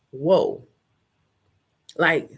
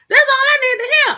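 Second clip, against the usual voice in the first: high, loud shout, bigger on the repeat; full hammed-up shout; gleeful shout; 8 dB louder, faster than usual. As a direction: yell it like you're cutting loose. Repeats line even bigger.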